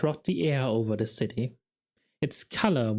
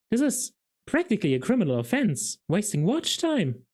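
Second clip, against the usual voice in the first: indignant Carrot; 8 semitones higher than usual. A narrator's reading.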